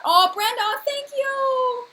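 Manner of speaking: high, loud delighted thanks; excited. Real, not acted. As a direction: gush over the gift. Thrilled by viewer super chat.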